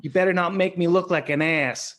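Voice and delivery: laughing Boston accent impression; Boston accent, half laughing; [name]'s Boston accent. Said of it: "in his Boston accent".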